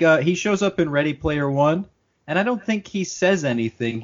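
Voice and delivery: ordinary talk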